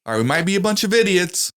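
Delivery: loud, high mock general rallying the troops; general's speech. General addressing troops before war, hammed-up loud speech. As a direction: shout it like a general hyping up idiots. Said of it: "but the general goes".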